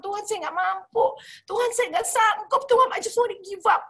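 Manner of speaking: telling God she wants to give up, despairing; despairing plea. Retold or imitated. Pleading she can't go on.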